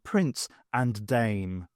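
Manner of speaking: usual speaking voice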